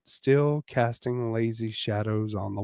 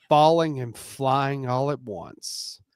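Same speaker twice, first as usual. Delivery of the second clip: usual speaking voice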